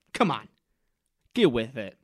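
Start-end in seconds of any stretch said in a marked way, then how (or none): none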